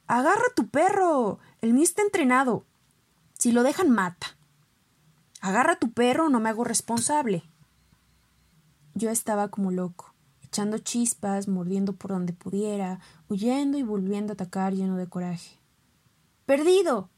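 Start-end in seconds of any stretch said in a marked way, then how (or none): 0.1-7.4 high, loud shouted warning; angry shout. Acted. Neighbor yells at the other owner over the dogfight, loud angry shout. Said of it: "le grita al mío". 16.4-17.1 loud, high call of the dog's name; shouted name. Acted. Master yells at his fighting dog, loud sharp call. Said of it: "me gritó mi amo".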